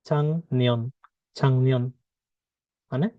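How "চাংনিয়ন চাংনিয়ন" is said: "চাংনিয়ন" with ng in place of k; nasalised pronunciation. Shown as a demonstration. Teacher answering her question for learners, nasalised pronunciation. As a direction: glide from ng into n in "চাংনিয়ন". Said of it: "না হয়ে কি হবে".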